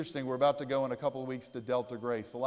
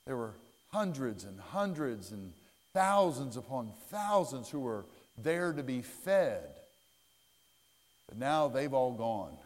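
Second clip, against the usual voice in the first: ordinary talk, nothing marked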